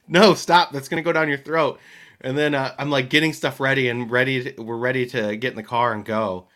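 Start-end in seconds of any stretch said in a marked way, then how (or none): 0.1-1.8 loud urgent no, stop; dad shouting to stop his daughter. Retold or imitated. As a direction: call out in a panic to stop her. Panicked dad retold.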